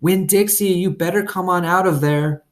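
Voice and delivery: high, loud call to the dog; yelling for the dog at the gate; hollered call. A narrator's reading. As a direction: call him out of the yard.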